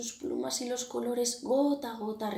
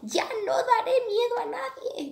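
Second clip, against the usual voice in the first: much higher crow voice, set apart; the crow vows to stop frightening the birds, much higher; crow's hopeful vow; 4 dB louder than usual. A narrator's reading. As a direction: voice the crow, full of hope. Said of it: "dice Cuervo".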